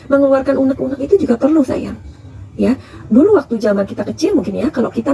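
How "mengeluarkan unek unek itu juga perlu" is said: usual speaking voice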